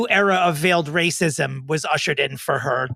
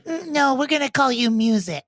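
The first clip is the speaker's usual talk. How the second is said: high, put-on Gwen voice; mock Gwen, lightly voiced; imitating Gwen; 6 semitones higher than usual. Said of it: "and Gwen's like".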